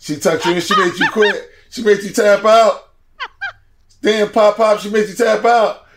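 put-on teasing an old friend, cracking up; mock teasing laughter. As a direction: rib him through the laughter. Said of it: "And we joked him. We said".

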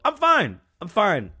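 forced brave. Pretend. Voicing self-denial.

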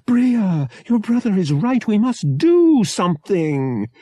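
high, loud flustered fretting; gasped exclamation. A narrator's reading. Mother to Bria about her brother, gasped exclamation. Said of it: "alternating between gasped exclamations of".

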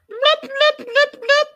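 loud squeaky high lip blops; imitating Darcy. Vlogger redoing Darcy's alien skit, loud high lip pops. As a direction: pop the lips like Darcy did on screen. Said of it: "I'm just imitating what she was doing".

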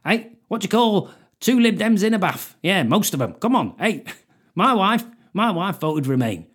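mock comic telling gags on Question Time; cheesy club comic. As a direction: ham it up like an old working-men's club comedian. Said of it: "walk out there and go".

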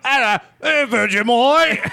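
very high, loud mock Australian; hammed-up Australian squawk; bad Australian accent. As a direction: shout it as a clueless fake Australian. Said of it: "Now make it Australian".

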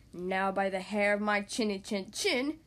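low, slow wolf voice; growing roar from a furious storybook wolf. A narrator's reading. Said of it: "he roared".

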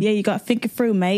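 hammed-up British accent; jokey mock-British accent; put-on British accent. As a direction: play it as a Brit for laughs. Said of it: "I'll talk in an accent".

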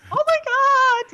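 high, loud, slow "oh my God"; shocked gasp. Retold or imitated. Herself seeing her phone blowing up, shocked. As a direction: react like you just saw the flood of texts. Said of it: "I would be like".

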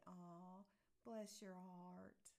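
slow, drawn-out tender blessing; sweetly grateful. Acted. To somebody who brought you flowers, sweetly grateful. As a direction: linger on it tenderly, like a thank-you hug. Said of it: "now on a good note".

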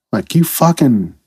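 ordinary delivery

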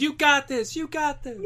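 high, pumped-up hype man. Pretend. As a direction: cheer them on from the sidelines. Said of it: "There's just a hype man in the background".

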